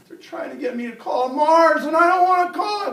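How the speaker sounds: put-on high, loud, distraught kid; crying. Crying Kyle explains to his classmate, tearful wailing. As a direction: wail it like a panicked boy. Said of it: "Why are you crying".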